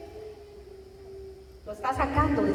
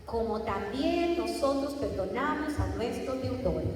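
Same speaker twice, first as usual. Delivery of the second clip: slow, deliberate reading leaning on "también"; slow scripture read with "también" stressed; 5 dB quieter than usual. Shown as a demonstration. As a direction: linger on "también" as the key word. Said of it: "Ese pedacito".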